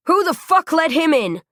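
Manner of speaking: loud, high furious shout; angry yell. A narrator's reading. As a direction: bark the question at everyone. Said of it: "Jack yelled".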